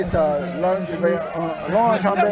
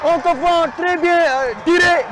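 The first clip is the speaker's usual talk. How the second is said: excited sports commentary; 9 semitones higher than usual. Real, not acted. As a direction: shout the goal with joy. Commentator calls a goal.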